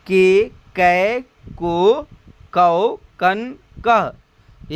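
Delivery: के, कै, को, कौ, कन, कह one at a time; teacher's spaced-out recital of each syllable; vowel-sign drill. Shown as a demonstration. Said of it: "उसकी चढ़ाव उतराव क्या है".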